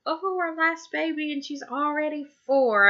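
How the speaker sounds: high-pitched, wistful little lament; mock sad parents. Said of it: "are really sad".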